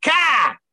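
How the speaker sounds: a hammered R sound, "Ka!", thrown in out of nowhere; hammered Boston R. Shown as a demonstration. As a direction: punch out "Ka!" as the R that jars you. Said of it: "they hammer on that R out of nowhere".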